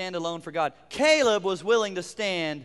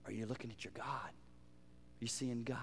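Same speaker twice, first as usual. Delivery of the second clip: hushed question; 8 semitones lower, 19 dB quieter than usual. Real, not acted. Pastor quietly asking where their eyes are, hushed. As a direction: draw them in with a near-hush.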